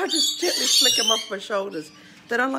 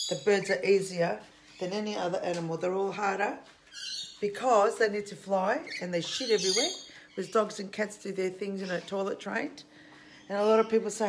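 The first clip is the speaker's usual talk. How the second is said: normal talking voice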